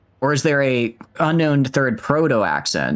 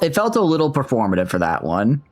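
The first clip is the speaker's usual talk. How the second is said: ordinary talk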